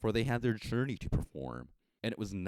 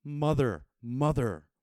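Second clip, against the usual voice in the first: loud, higher call of Mother; crying out; 7 semitones higher, 7 dB louder than usual. Acted. Child in heaven calling to its mother, crying out loud. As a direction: call her name with longing. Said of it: "But the voice cried".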